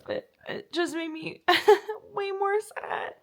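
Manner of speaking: real teary half-laugh; crying while laughing. Real, not acted. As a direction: let the tears crack the words. Tearful admission.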